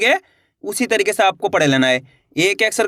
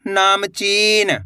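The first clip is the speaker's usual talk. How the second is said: नामचीन very slowly, vowels held long; sounding out; much slower than usual. Shown as a demonstration. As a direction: draw out नामचीन for learners to repeat. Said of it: "मेरे साथ साथ इनको repeat". (नामचीन, धीरे-धीरे खींचकर)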